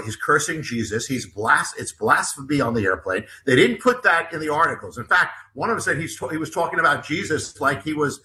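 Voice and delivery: normal talking voice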